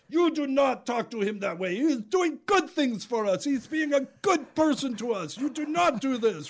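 a hint of an angry local man telling off a drunk; stern rebuke. Local man scolding the drunk for harassing the juggler, lightly voiced firm reproach.

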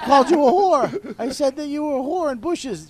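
loud and high, blurting it out; hammed-up shout at mom; retold yell. Kid yelling the insult to his mother, loud and high.

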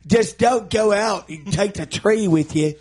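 mock Cockney. Joking about the singer's talking part, mock Cockney. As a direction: play a cheeky Cockney geezer. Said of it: "he has an English accent".

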